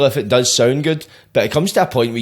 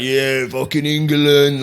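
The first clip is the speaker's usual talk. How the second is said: slow, drawn-out mock chant of Yeah, fucking England; lightly voiced England fan chant; mock English patriot; much slower than usual. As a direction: ham up a proud lad's chant. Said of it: "That mad nationalistic pride".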